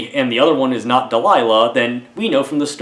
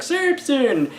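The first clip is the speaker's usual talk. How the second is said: hammed-up dramatic name-call, pitched way up; villain calling out; 13 semitones higher than usual. Reviewer repeating the prince's call to Samson, high mock cry. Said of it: "why he announces himself".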